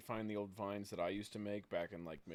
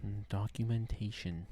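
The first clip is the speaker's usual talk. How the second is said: ASMR voice; 5 semitones lower, slower than usual. Pretend. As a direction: draw the word out like ASMR. Mocking ASMR word of the day.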